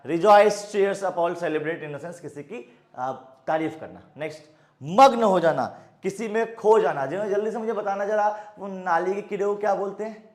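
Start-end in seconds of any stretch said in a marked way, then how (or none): none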